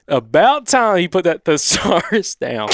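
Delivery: slow, high Southern drawl; careful, put-on Southern accent; Southern twang. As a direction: draw it out in country Alabama twang. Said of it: "trying to do the accent".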